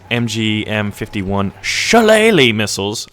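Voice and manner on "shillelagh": loud, high, stretched-out Shillelagh; playful crowing on one word; relishing the word. Pretend. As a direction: savour the word with playful gusto. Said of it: "I love that word".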